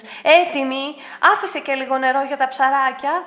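loud teasing call; shouted. A narrator's reading. Dick teasing the thirsty dog, loud shout. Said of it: "φώναξε ο Ντίκ".

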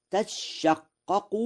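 تشقق with a doubled sh, slow and high; doubled shin. Shown as a demonstration. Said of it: "بتشديد الشين". (تشقق بتشديد الشين)

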